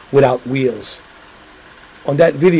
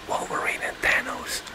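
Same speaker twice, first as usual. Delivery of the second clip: much softer conspiratorial whisper; whispering a spoiler to the chat; secret whisper; 13 dB quieter than usual. Real, not acted.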